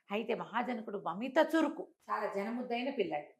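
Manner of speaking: ordinary delivery, nothing marked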